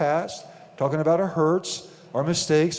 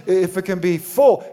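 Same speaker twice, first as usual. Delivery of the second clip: full punched out loud; a raised, ringing full for the congregation; stress on full; 3 semitones higher, 6 dB louder than usual. Shown as a demonstration. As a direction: hit the word full hard. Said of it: "he emphasizes the word full reward".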